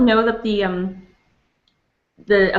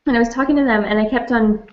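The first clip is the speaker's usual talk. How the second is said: ordinary delivery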